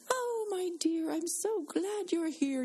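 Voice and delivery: high, slow British lady's voice, imitated; imitating a British accent. Speaker imitating injured friend's British greeting in hospital, high and slow British accent. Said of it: "in her beautiful British accent".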